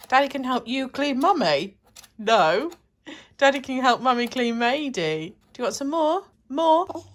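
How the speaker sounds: playful cooing. Real, not acted. Mother playfully talking to her baby at lunch.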